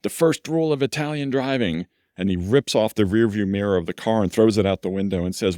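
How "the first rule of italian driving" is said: put-on Italian accent. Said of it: "in a little Italian accent".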